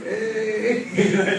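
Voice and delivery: high, drawn-out mock cheer; imitated cheer. Guest imitating the garage mechanics greeting him, mock cheering. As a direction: imitate a crowd's teasing cheer. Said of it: "Cheering you on".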